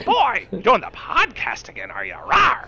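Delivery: high yelling dinosaur-like roar; Mar voice. Pretend. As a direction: bellow it as a roaring old lady. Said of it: "Mar yelling about something".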